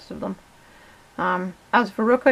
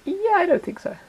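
high-pitched mock reluctance for a pet; voiced doubtful guinea pig; 9 semitones higher than usual. Owner voices guinea pig unimpressed by the car, mock-doubtful. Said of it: "she's just like".

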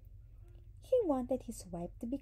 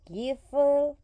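loud, higher-pitched scolding wife; angry cry; 5 semitones higher, 12 dB louder than usual. A narrator's reading. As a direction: cry out loudly at a husband. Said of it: "she cried".

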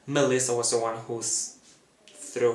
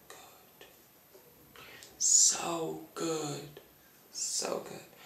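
whispered relish; 3 semitones higher, 6 dB quieter, much slower than usual. Real, not acted. Savouring the imagined finale.

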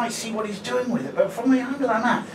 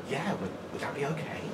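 soft, low, hesitant asking; timid request; 5 semitones lower, 13 dB quieter than usual. Retold or imitated. Shop worker asking a drug user to leave, timid and soft. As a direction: ask softly, stumbling as if afraid to impose.

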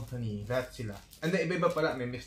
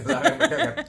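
high laughing squeal; 9 dB louder, faster than usual. Real, not acted. Laughing about bitten bread returned.

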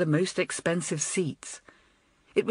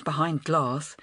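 usual speaking voice

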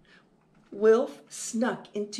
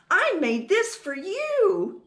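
high, loud, proud little voice; proud cry; 9 semitones higher, 5 dB louder than usual. Acted. Little mouse giving Mom a surprise, proud cry. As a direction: present it proudly in a raised voice. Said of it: "he cried proudly".

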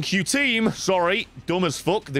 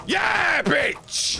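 triumphant shout. Real, not acted.

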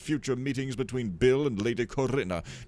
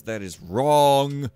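loud, high, drawn-out WRONG; indignant outburst; 6 semitones higher, 10 dB louder, much slower than usual. Real, not acted. Player objecting to the game, indignant cry. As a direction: stretch out "wrong" loudly in indignation. Indignant, then repeated in usual voice.

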